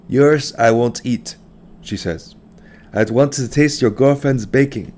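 lightly voiced immigrant mother, scolding; a hint of a stern mother chiding her son; mother's voice. A narrator's reading. Narrator voicing the mother, mildly scolding.

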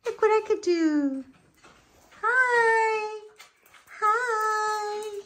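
high, excited little dog showing off; dog's voice. Pretend. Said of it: "She's like".

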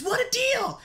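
very high-pitched, shrill gnome mimicry. Kenku waves the party to stop, shrill gnome voice. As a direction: squeal it like a little gnome. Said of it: "in a shrill, high-pitched, what sounds like a gnome's voice".

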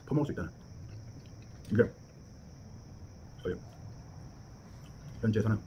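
chewing while talking; speaking around a mouthful; mouth full. Real, not acted. Seen 2: Spoons avocado into mouth at 0.7, then speaks while chewing.

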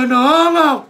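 loud, high protest; panicked kid. Retold or imitated. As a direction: cry out no like a scared boy. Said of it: "Y yo".

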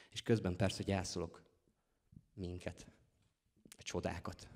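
quiet, halting lines with long pauses; subdued grief. Acted. Poet mourning the relationship and its wonders, quiet grief. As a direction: let each loss drop away softly. Poet says they are grieving.

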